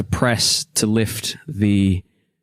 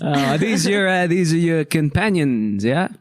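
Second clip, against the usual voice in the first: lightly voiced, higher-pitched gnome; a hint of a chatty gnome meeting strangers, pitched up; 6 semitones higher than usual. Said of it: "And the gnome says".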